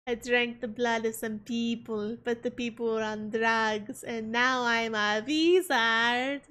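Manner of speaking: put-on vampire voice, slowing on the last line; mock vampire confession; vampire show quote. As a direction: quote a vampire, drawing out the final word. Quoting the vampire show's character.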